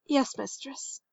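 soft, meek reply; whispered. A narrator's reading. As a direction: answer the mistress very softly. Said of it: "Bentu whispered".

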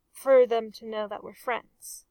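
ordinary delivery, nothing marked

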